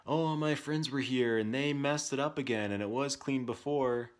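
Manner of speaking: put-on whiny child excuse. Son's excuse voiced in retelling.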